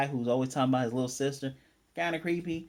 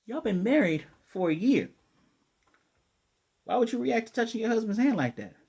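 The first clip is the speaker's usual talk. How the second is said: suspicious brother; 8 semitones higher, 4 dB louder than usual. Retold or imitated. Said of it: "And dude's like".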